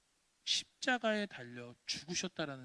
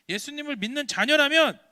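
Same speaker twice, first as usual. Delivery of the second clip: loud, higher preaching climax; rising call; 9 semitones higher, 15 dB louder than usual. Acted. Preacher exhorting children, louder climax. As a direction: build and call it out. Building repetition.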